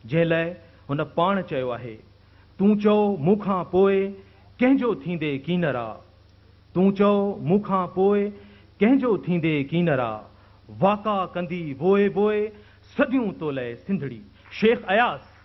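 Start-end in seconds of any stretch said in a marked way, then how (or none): 2.6-14.2 raised pitch, phrase by phrase with pauses; impassioned verse recitation. Acted. Host quotes the guest poet's own verse, declaimed recitation. 14.6-15.3 high, ringing stage introduction; announcing a name. Real, not acted. Host presents Shaikh Ayaz to the audience, raised announcing voice.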